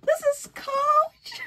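high, loud, slow squealing; excited shriek. Real, not acted. Showing off her new bag, squealing loud and high. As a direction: squeal over the bag, laughing.

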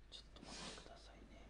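quick, barely audible whisper; whispered aside. Real, not acted. Presenter stalling for a moment, hushed whisper. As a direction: whisper it hurriedly under the breath. Stalling while searching for material.

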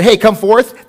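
tossed-off, loud and offhand Hey, come forth; casual call. Shown as a demonstration. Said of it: "if he would've just said".

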